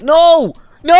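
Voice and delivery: loud panicked scream. Real, not acted. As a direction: yell it in sudden panic. Caught in the explosion.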